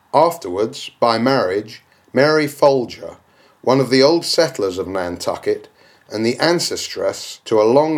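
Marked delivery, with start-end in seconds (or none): none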